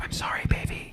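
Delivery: soft whispered apology in the ear. Pretend. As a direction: whisper it tenderly into someone's ear. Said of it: "I'll walk up to your ear like".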